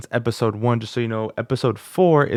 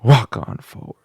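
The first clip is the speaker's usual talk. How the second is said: louder, powered from the stomach; belly power; 6 dB louder than usual. Shown as a demonstration. Student adds belly power to the lyric. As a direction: drive it from the gut. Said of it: "add the power ... bottom of the belly".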